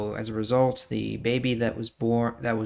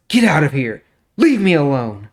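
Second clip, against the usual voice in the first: raised, louder order to clear the room; angry dismissal; 4 semitones higher, 11 dB louder than usual. Acted. Amnon ordering his servants out, loud and angry. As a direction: bark them out of the room.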